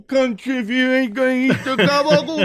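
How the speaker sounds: high, loud hammed-up Staten Island wise guy; mocking a Staten Island guy scolding outsiders; Staten Island accent. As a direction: jeer it like a Sopranos extra. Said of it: "very that accent".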